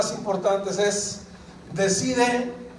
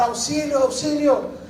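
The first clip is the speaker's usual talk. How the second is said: high-pitched mock yell of a drowning person; retold cry for help; 8 semitones higher than usual. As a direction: call out for help, half-acted. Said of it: "empieza a gritar". (grito de auxilio imitado)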